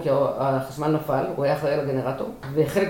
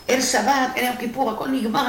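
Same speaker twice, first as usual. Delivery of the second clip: retold shouting; 7 semitones higher than usual. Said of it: "פרצתי עליה בצעקות".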